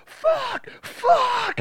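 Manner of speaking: frantic squeaky cursing; panicked high yelp. Retold or imitated. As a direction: shriek it in a panic. Cop panicking at the gas.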